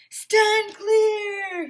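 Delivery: high, loud command; loud high superhero shout; heroic shout. A narrator's reading. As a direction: order everyone back like a hero. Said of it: "he shouted".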